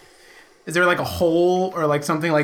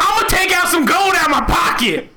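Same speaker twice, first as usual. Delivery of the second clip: shouting; 9 semitones higher than usual. Acted. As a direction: raise your voice so he can hear. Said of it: "Speak up, I can't hear ya".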